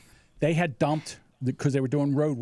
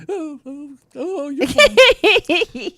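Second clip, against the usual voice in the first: high squeal, slowing and swelling loud; squeamish mom; 11 semitones higher, 11 dB louder, much slower than usual. Retold or imitated. Mom who can't handle blood inspects his head, high squeamish squeal. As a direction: imitate mom queasily faking reassurance.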